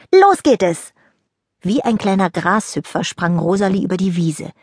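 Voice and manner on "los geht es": high, loud cheer; eager start. Acted. Rosalie calls the friends to start dew-running. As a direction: launch them into the game.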